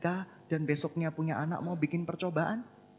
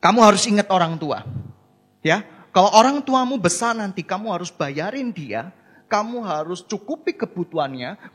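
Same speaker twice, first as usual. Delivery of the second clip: louder, lecturing grandmother hammering harus; demanding grandmother; 8 dB louder than usual. Retold or imitated. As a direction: insist on duty relentlessly. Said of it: "mengekspresikan cinta seperti ini".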